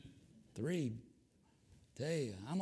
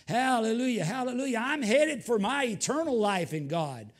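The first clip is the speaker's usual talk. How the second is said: loud, higher, shouting preacher; full-force preaching; 8 semitones higher, 9 dB louder than usual. Acted. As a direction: lift the room with a shout of faith.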